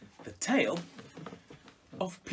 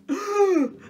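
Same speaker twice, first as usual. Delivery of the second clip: sharp gasp; 14 semitones higher, 11 dB louder than usual. Acted. Peter escapes just in time.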